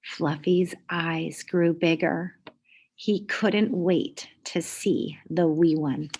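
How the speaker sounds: usual speaking voice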